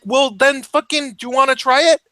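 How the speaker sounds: high, pushy dare; mock tough-guy challenge; retold heated challenge. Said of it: "So I'm like".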